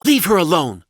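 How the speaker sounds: loud, high-pitched wife yell; angry yell. A narrator's reading. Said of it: "Laura yelled".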